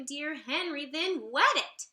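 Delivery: plain reading voice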